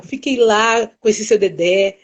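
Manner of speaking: normal talking voice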